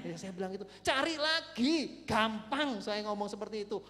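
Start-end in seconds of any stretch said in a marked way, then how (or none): 0.8-2.8 loud, stretched-out retold advice; brash encouragement. Counselor urges dumped teen to look again, loud and breezy. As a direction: call it out loudly, drawing out the first words.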